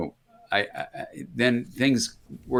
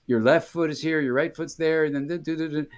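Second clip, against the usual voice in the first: lightly mimicked instructor, pitched higher; a hint of a teacher calling steps, in a higher voice; mock dance teacher; 6 semitones higher than usual. Dance teacher telling a student where the feet go, lightly mimicked. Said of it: "when a teacher was there going".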